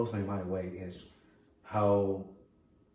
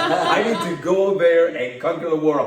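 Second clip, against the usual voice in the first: louder and higher through laughter; laughing boast; 7 semitones higher, 11 dB louder than usual. Real, not acted. Host inspired by guest, laughing louder boast. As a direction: laugh out your big plan to conquer the world.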